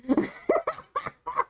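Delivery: squealing giggle fit; a real breathless cackle; high shrieking laugh. Real, not acted. Streamer losing it over a word, shrieking laugh. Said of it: "losing it".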